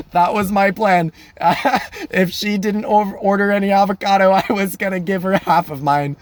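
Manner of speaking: high-pitched, giggling admission; laughing confession. Real, not acted. Man admitting his avocado plan to listeners, laughing. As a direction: confess it through real laughter.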